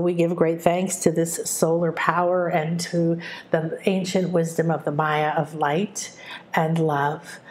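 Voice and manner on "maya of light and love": prayer. Acted. Speaks a prayer aloud.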